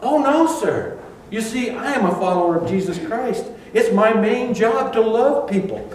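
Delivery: high, sweet, earnest reply; cheerful follower. Pretend. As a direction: beam with innocent eagerness. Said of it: "your response can be".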